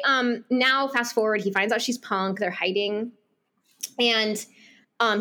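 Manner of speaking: ordinary talk, nothing marked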